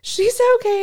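high, loud, slow call to a passerby; calling out reassurance. Retold or imitated. Driver reassuring a stranger on the street, loud call. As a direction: call out the window that all is fine. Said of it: "I had to be like".

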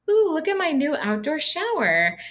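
high sing-song mock excitement; put-on high-pitched oohing; mock showing off. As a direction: imitate a relative gushing over a new toy. Voicing a relative's boastful text.

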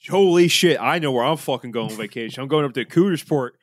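loud, high, thrilled yelp of disbelief; excited disbelief. Real, not acted. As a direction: shout it like you can't believe the name. Delighted by the town name.

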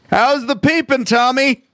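high-pitched imitated shout; Philip Seymour Hoffman shout. Quoting the greatest line reading, imitated high shout.

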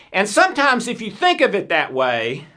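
ordinary talk, nothing marked

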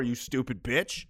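ordinary talk, nothing marked

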